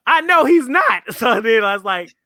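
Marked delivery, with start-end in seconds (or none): 0.1-1.0 high, loud disbelieving villain voice; much higher mock villain, scoffing; mock incredulous villain. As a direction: play the villain, outraged he'd dare. Voicing villains in retold scene.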